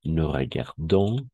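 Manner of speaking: Nous regardons, vous regardez said slowly with every ending; slow full endings. Shown as a demonstration. Teacher showing the two exceptions to students. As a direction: stretch the endings out. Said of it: "ahí vamos a pronunciar todo".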